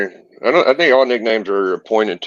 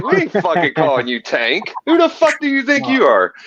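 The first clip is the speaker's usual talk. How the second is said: mocking scoff; 9 semitones higher than usual. Class mocks kid's self-nickname.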